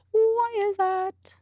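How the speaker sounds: high-pitched whiny question; high whine. Real, not acted. Exasperated repeat.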